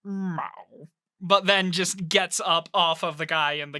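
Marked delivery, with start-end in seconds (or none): 0.0-0.4 soft, regretful meow; regretful creature. Acted. Pet beast called off its captive, softly regretful. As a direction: give one reluctant, quieter meow. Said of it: "kind of regretfully, like, looks up".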